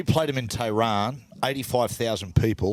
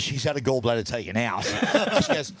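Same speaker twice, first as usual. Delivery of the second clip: ordinary talk, nothing marked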